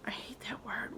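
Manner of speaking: whispered distaste; whispering. Real, not acted. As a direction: hush it like a confession. Disgust aside about word.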